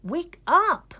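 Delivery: high, loud and urgent wake-up call; urgent cry. A narrator's reading. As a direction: rouse him, calling out eagerly. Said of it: "cried Greta".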